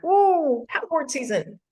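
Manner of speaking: high gushing ooh; excited squeal. Retold or imitated. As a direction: gush about summer fun. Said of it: "They've all been like".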